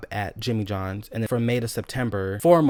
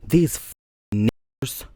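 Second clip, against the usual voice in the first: usual speaking voice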